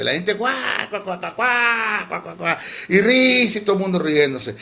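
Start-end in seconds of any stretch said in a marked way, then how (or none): 0.3-2.6 imitated drawn-out "guá" guffaws, higher then falling off; mock laughter. Preacher mocking the anointing of laughter, imitated guffaws. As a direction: mimic a crowd guffawing in exaggerated bursts. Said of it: "toda la gente riéndose".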